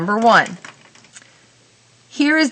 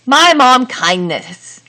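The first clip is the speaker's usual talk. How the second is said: slow, raised mock trial reading; testing a wrong sentence; 4 semitones higher, 4 dB louder, slower than usual. Shown as a demonstration. Teacher tries another wrong sentence for students, slow and raised. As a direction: sound it out so it clearly fails. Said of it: "and I can't say".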